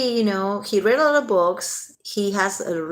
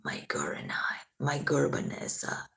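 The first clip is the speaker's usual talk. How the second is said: Johnny; 4 semitones lower, 8 dB quieter than usual. Retold or imitated. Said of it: "He said".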